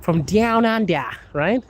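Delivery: slow, high Aussie twang; twangy Australian accent. Shown as a demonstration. Streamer showing viewers how twangy it is, Australian accent. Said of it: "the accent is so twangy".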